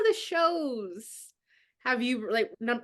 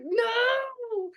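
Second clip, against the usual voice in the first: anguished cry; 9 semitones higher than usual. Retold or imitated. Reacting to sad scene.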